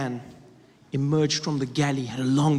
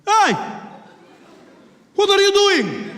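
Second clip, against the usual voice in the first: put-on high, loud accented demand; challenging shout; 7 dB louder, slower than usual. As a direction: call him out sharply in an Arabic accent. Passenger confronts the bomber.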